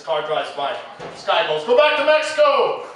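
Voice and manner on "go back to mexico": heckler yelling from car. Retold or imitated. Guy yelling from passing car.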